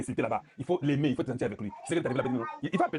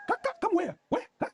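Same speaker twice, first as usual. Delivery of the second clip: jumps much higher in a mocking English accent; mock squeak. Mocking Kagame's invitation, in a sudden high English squeak.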